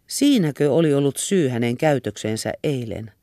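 plain reading voice

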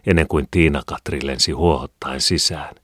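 usual speaking voice

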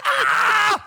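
high startled aah; a mock horrified yelp; shocked gasp. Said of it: "Niin mä olin vaan niinku".

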